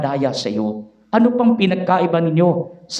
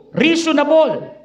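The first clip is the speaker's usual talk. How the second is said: drawn out, higher mock approval; mock reasonable; 7 semitones higher than usual. Preacher voicing the devil's pitch, sly mock approval. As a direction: sell it like a sly tempter. Devil's tempting logic mocked.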